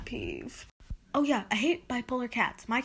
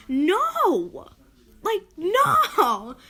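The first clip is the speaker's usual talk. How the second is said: loud, high outburst of "no"; exasperated shout; 6 semitones higher, 7 dB louder, much slower than usual. Real, not acted. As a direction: shout it down in protest. Ranting about dish dumpers.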